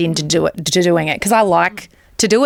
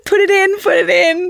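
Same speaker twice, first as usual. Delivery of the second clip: mimicking gym woman; 11 semitones higher than usual. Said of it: "So I'm like".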